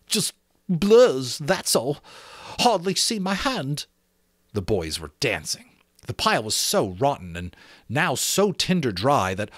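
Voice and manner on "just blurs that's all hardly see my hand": plain reading voice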